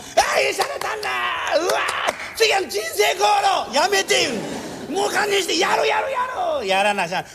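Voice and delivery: high, delighted self-praise; tipsy self-congratulation; giddy master. Retold or imitated. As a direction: crow like a tipsy master pleased with himself. Drunk master loving his own act.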